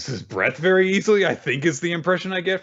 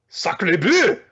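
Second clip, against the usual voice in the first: French exclamation; 5 semitones higher, 4 dB louder than usual. Retold or imitated. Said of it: "one French student".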